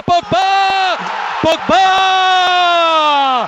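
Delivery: long roaring goal call; goal shout. Real, not acted. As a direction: scream the scorer's name.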